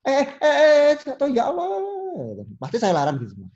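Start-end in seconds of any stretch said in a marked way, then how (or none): 0.0-2.4 high, loud alarmed cries, imitated; mock panic over a fallen toddler, much higher and louder; panicky relatives. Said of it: "terus mertua itu pasti". (tiruan panik, jauh lebih tinggi dan keras)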